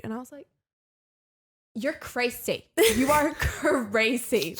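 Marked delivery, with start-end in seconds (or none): 1.8-4.6 drawn-out, laughing disbelief; exasperated, laughing. Retold or imitated. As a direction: say you're crazy laughing. Retold exasperated reply.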